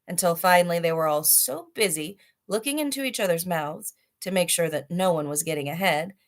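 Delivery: ordinary delivery, nothing marked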